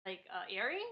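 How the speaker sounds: ordinary talk, nothing marked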